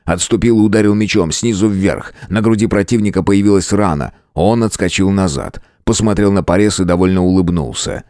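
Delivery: ordinary delivery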